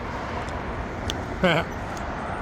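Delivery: soft breathy laugh. Real, not acted. Laughs at own bagel remark.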